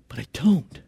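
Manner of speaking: a whispered admission of not knowing. Retold or imitated. Frightened disciple confessing confusion, whispered. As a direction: breathe the words out with no voice.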